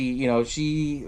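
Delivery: ordinary delivery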